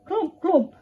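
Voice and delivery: high punchy clomps; clomping stick. Acted. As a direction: knock each clomp like a wooden stick. Stick sound effect.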